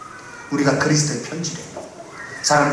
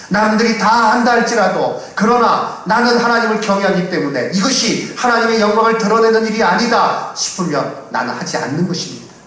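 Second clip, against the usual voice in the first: raised, high-pitched pulpit delivery; emphatic preaching; 8 semitones higher, 4 dB louder than usual. Real, not acted. Pastor urging believers to refuse what dishonours God, emphatic preaching. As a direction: press each phrase on the congregation with force.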